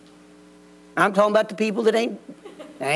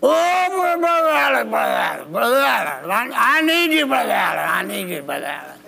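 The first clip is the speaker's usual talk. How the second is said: put-on drunk pleading kid. A drunk kid pleads with the preacher at 2 a.m., slurred and desperate. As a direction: beg like a drunk kid at the door. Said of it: "drunk as a skunk".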